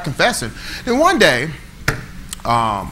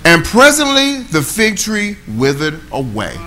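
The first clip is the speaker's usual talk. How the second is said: scripture reading; 4 semitones higher, 4 dB louder, slower than usual. Acted. Preacher reads scripture slowly.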